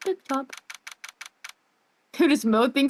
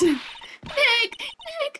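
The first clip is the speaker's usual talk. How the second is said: high call. Acted. Calling out to Nick.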